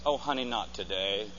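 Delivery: weary inner thought. Acted. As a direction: mutter it to yourself, worn out. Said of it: "David thinks".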